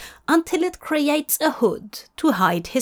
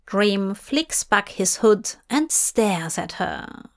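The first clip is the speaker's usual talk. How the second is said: plain reading voice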